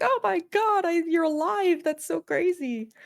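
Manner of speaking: high, astonished squeal; shocked villager voice. Retold or imitated. Villagers greeting the long-gone player, high and astonished. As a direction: act the villager amazed you're alive. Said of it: "everybody's like".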